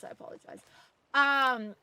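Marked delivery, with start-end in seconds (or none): 0.0-0.6 softly whispered sorry; whispered apology. Real, not acted. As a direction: drop to a whisper. Trailing apology to chat.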